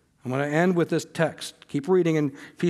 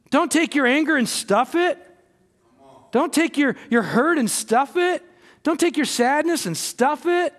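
impassioned preaching; 6 semitones higher than usual. Acted. Preacher at full force.

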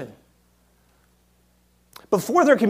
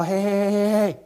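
Spirit's voice; 2 semitones higher than usual. Acted. Spirit's warning voice.

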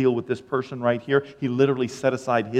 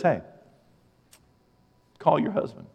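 usual speaking voice

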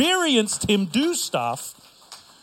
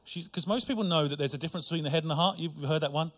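ordinary talk, nothing marked

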